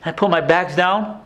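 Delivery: ordinary delivery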